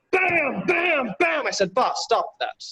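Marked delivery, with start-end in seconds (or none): none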